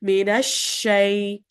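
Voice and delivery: Minash shay slowly with a wide spreading sh; spread sheen. Shown as a demonstration. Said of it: "You're still holding the, the spreading".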